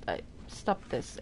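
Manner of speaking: whispering under breath; whispered. Real, not acted. Creeping up on snake.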